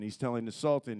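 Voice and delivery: ordinary talk, nothing marked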